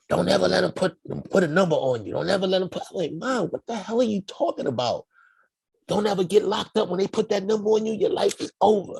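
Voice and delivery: high, insistent mom warning; preaching mother. Retold or imitated. Mother warning her small child, high and insistent. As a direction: drill it in like a strict mom.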